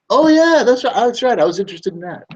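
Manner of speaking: very high, loud, excited voice of a reminded customer; mock customer. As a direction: squeal it out as the customer who remembers. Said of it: "customer reminded again".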